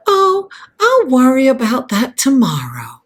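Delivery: hammed-up American movie-heroine accent; Scarlett O'Hara. Quoting Gone with the Wind to viewers, really bad American accent.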